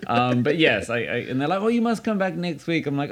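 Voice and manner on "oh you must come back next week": high-pitched gushing imitation; mock-polite invitation. Others urging a return next week, mock-polite invitation. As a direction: put on a high, insistent host voice.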